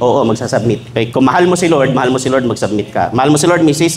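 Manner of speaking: usual speaking voice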